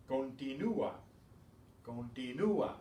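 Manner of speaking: Continúa as con-ti-NU-a, stress on U; Spanish Continúa with a full, stressed U; U pronounced separately. Shown as a demonstration. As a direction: keep the u and a apart in Continúa. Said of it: "pronounce that U separately".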